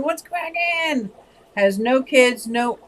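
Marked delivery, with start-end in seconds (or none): 0.0-1.1 mock scream. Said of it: "I think you're screaming".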